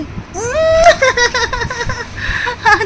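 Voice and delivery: delighted high-pitched laugh. Real, not acted. Aunt laughing at the baby's antics, high delighted laugh.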